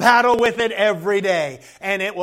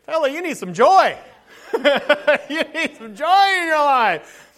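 laughing, higher teasing advice; 5 semitones higher, 3 dB louder than usual. Real, not acted. Teasing the grumpy man he needs joy, laughing. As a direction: chuckle through the advice. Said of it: "I'm like".

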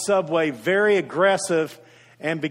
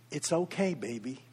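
soft, hushed soothing to a big angry man; a gentle grandmother's hush; tender comfort; 3 semitones lower, 7 dB quieter than usual. Retold or imitated. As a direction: murmur it like a grandmother calming a child.

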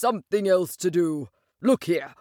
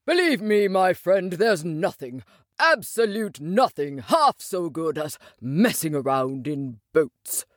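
dreamy. Acted. Rat extols boats to Mole, dreamily. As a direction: linger lovingly on the words. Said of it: "he went on dreamily".

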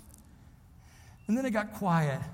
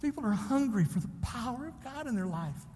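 ordinary talk, nothing marked

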